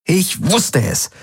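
high, slow teasing; knowing tease. Acted. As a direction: draw it out like you caught him. Teasing lover.

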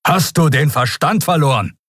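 officer dressing someone down; indignant scolding. Acted. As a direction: demand it in disbelief. Admiral scolds the prisoner.

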